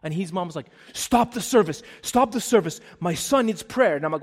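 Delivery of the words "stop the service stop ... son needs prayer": loud, higher imitation of a pleading mother; retold urgent plea. His mom demands prayer for her son, retold loud urgent plea. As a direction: call out urgently as the boy's mother.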